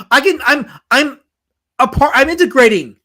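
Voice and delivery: loud, high, insistent; insistent protest. Real, not acted. Streamer defending his accent to the chat, insistent and loud. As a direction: protest your case at full voice.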